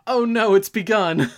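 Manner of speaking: high, loud mock-horrified cry; mock dismay. Host reacting to fans swooning over the villain, mock dismay. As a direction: wail it in playful alarm.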